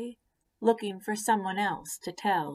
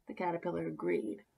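usual speaking voice

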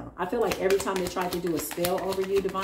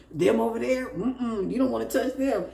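higher mock warning, amused; imitated gossips; 6 semitones higher than usual. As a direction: play the onlookers warning people off. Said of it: "That's how they sound".

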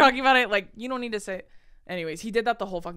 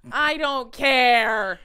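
loud, raised, very drawn-out declaration; hammed-up wail of not caring; exaggerated indifference; 4 semitones higher, 8 dB louder, much slower than usual. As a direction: belt it out slowly, utterly uninterested. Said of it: "That's how I felt".

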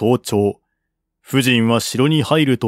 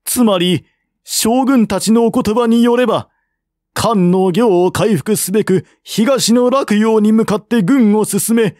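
a lady's higher, earnest pleading voice; lady questioning generals; 11 semitones higher than usual. Acted. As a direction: press the generals politely but firmly.

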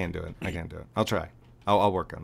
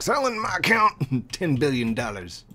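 mock greedy seller; 5 semitones higher, 5 dB louder than usual. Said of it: "J B's over here like".

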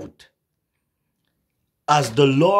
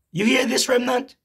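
much higher rousing call; calling out to congregation; 11 semitones higher than usual. Real, not acted. Preacher challenges the congregation, high and urgent. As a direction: rouse the remnant, pitch up.

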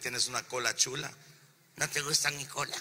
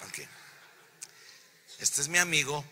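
ordinary delivery

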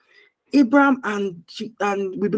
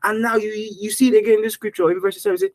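ordinary delivery

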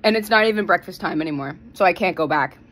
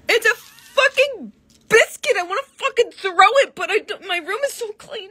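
tearful outburst; 10 semitones higher than usual. Real, not acted. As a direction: rage and whine at the biscuit. Biscuit meltdown, near tears.

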